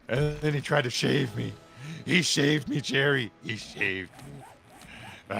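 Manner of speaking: hammed-up wail, pitch climbing; mock dramatic lament. Streamer quoting a sitcom dad's lament, hammed-up. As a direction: overact a father's absurd grief.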